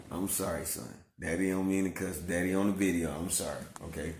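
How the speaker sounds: very soft, low soothing voice; tender apology. Real, not acted. Daddy consoling son while giving a hug, low tender murmur.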